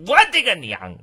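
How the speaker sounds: ordinary talk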